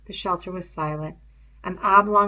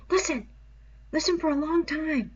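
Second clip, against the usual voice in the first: put-on higher urgent boy's voice; 9 semitones higher than usual. A narrator's reading. Piggy urging Ralph to listen, higher urgent voice. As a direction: press him to listen.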